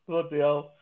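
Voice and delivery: ordinary delivery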